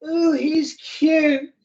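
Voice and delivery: high, slow, drawn-out ooh of attraction; mock a girl spotting a cute guy; imitating a dreamy crush voice; swooning admirer. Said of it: "and you go".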